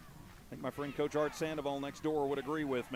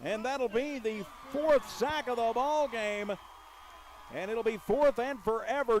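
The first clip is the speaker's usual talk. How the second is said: excited; 7 semitones higher, 6 dB louder than usual. Real, not acted. Sack call in play-by-play.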